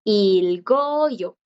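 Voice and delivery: "Ilgoyo" slowly, with the G heard before the vowel; stretched teacher's model of "Ilgoyo"; G sounded. Shown as a demonstration. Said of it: "la vocal sí le permite sonar".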